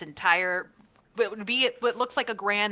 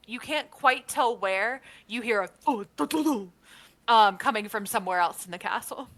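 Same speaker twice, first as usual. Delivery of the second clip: ordinary delivery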